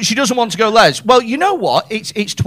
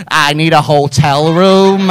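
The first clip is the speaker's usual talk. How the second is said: lightly voiced, lower and drawn out; slow, low put-on voice; acted bar customer; 5 semitones lower, slower than usual. Comic voices someone at the bar, low and slow.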